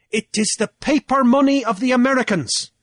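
high, loud declaiming challenge; Cornelius, triumphant orator. A narrator's reading. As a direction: orate forcefully to a whole assembly. Cornelius explains to council.

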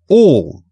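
ALL hit high and loud; great emphasis. A narrator's reading. King looking hard at Alice, "All" loud and high. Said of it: "he repeated with great emphasis".

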